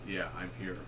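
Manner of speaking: low, soft movie-hero reply; Mel Gibson. Retold or imitated. Reviewer repeating Max's radio answer, low soft impression. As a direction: drop the voice low and quiet, laconic. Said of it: "It sounds like Mel Gibson".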